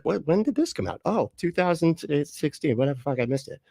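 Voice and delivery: high, startled then shrugging; surprised discovery. Retold or imitated. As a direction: act out finding the old album. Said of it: "It's like".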